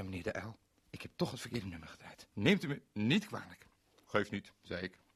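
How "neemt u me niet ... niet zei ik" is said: usual speaking voice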